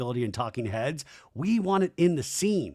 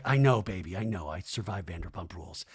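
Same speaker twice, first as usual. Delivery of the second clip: low murmur fading softer; mock soothing; 9 semitones lower, 5 dB quieter than usual. [name] retold comforting Nia, soothing and low. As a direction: settle right down to comfort someone.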